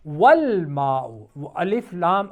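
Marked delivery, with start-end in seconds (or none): 0.1-1.0 والماء as wal-maa, alif unread. Shown as a demonstration. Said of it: "وہ پڑھا نہیں جا رہا".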